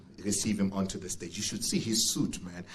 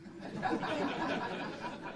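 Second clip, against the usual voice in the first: laugh; 6 dB quieter than usual. Real, not acted. MC laughs after cake joke.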